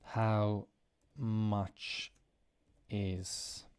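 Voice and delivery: very slow, with long pauses between words; slow, spaced-out model phrase for learners; word-by-word teaching. Shown as a demonstration. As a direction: dictate it slowly, one word at a time.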